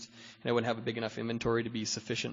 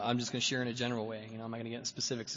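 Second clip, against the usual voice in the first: ordinary talk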